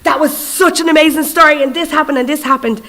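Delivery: put-on high, bubbly retelling of a thrilled kid; eager schoolgirl gushing about a story; excited. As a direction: gush like a kid who loved the book. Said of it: "so excited".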